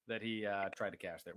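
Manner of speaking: talking between sips of beer; words around a sip of beer; while sipping a drink. Real, not acted. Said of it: "I'm drinking a ten percent beer".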